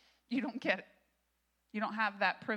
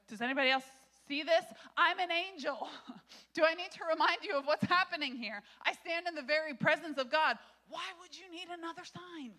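higher, louder, incredulous angel voice; exasperated angel; 7 semitones higher, 3 dB louder than usual. Acted. Pastor voicing the angel scolding Zechariah, exasperated. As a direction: play the offended angel who can't believe it. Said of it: "And the angel's like".

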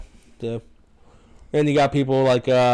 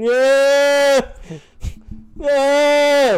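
mock pained yells; 13 semitones higher, 5 dB louder than usual. Patient faking chest pain outside.

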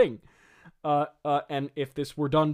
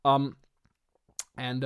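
normal talking voice

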